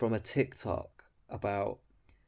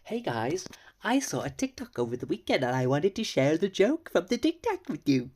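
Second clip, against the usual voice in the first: higher, louder sing-song parody of her; mocking fake-cheery voice; 6 semitones higher, 6 dB louder than usual. Mocking the woman's story opener, high and loud. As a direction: ham up a chirpy influencer tone. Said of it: "who the fuck even talks like that".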